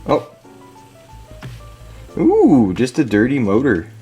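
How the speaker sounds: ordinary talk, nothing marked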